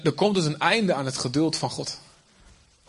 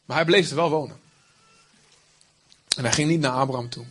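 ordinary talk, nothing marked

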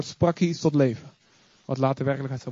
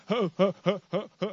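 nervous mock laugh; 2 semitones higher than usual. Peter stammering at the vision.

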